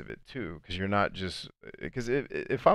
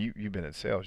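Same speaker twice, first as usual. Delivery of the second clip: normal talking voice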